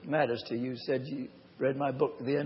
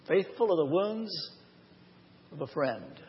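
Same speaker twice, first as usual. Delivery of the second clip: normal talking voice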